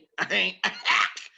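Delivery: hacking sick-dog cough; dog cough imitation. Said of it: "the dog coughed like that".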